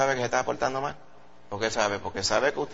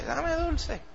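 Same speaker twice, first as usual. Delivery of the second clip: put-on high, soft little-kid plea; begging child; 14 semitones higher, 6 dB quieter than usual. The boy begs the candy man for sweets, high and soft. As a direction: ask sweetly like a small boy. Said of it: "para saltarme dulce".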